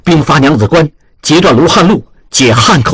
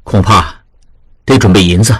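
lower, steady voice of a senior manager giving orders; lower, serious old boss; grave elder master; 6 semitones lower than usual. A narrator's reading. Master weighs the rescue.

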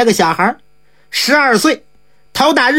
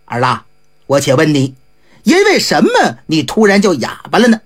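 gentle father; 9 semitones lower than usual. A narrator's reading. Squire gently asking his son what happened, lower. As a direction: coax the story from the boy.

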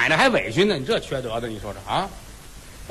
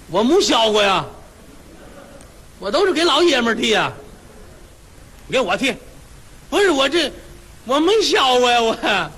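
higher, flustered barber voice; flustered protest; 6 semitones higher than usual. Acted. Barber protesting to the wife that he has never shaved a woman, flustered and higher.

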